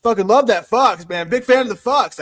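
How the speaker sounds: enthusiastic fan. Retold or imitated. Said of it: "I was like".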